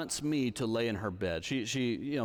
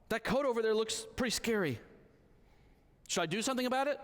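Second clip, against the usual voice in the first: put-on higher small-girl voice, scared; a child confessing fear at bedtime; little daughter; 8 semitones higher, faster than usual.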